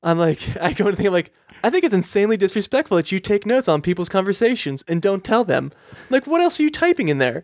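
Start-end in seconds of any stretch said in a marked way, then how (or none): 1.6-7.4 high-pitched indignant complaint; mock indignation. Customer accusing agent of secret note-taking, mock indignant. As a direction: challenge them, climbing high on the question.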